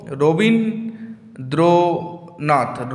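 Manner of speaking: "Rabindranath" very slowly, pausing between Rabin, দ্র and নাথ; teacher sounding out a name by syllables; split into syllables. Shown as a demonstration. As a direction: break "Rabindranath" into long separate beats. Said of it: "তাহলে কটি অক্ষর".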